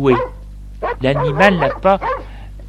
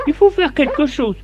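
high-pitched wife voice; storyteller's high, insistent wife; fed-up wife; 12 semitones higher than usual. A narrator's reading. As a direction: complain to your husband in a high voice. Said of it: "ta femme lui dit".

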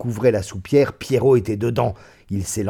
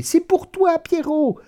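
far higher, calling down into the pit; 4 dB louder than usual. A narrator's reading. Two women calling to Pierrot in the pit, high call. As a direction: sing it out to the dog below.

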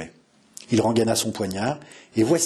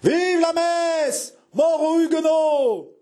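high, drawn-out rallying shout; delirious crowd cry; 4 dB louder, much slower than usual. A narrator's reading. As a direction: chant it like a frenzied mob, stretching the words. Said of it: "répétait la multitude qui délirait". (cri de foule en délire)

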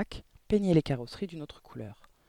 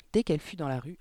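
usual speaking voice

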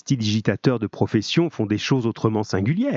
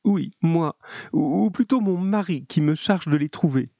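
higher, hesitant woman's voice; mildly anguished, halting reply in a raised voice; 6 semitones higher than usual. A narrator's reading. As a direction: stumble nervously into the explanation. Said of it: "Elle tremblait d'angoisse".

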